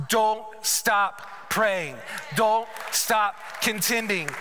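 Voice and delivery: slow, weighty plea; earnest urging. Real, not acted. Preacher urging praying mothers on, slow and earnest. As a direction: press each word home to the mothers.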